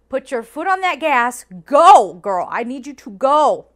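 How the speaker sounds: loud, pushy urging; urgent shouting. Retold or imitated. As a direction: yell at her to floor it. Urging shuttle driver to hurry.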